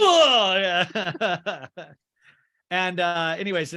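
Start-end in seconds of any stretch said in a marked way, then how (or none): 0.0-0.6 loud, high sliding-in whoop; Kramer. Retold or imitated. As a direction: burst in with a big whoop. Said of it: "but it's Kramer".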